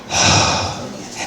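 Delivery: long breathy exhale of relief; acted-out sigh, all breath. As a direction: let out a big relieved sigh. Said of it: "let out this sigh of relief".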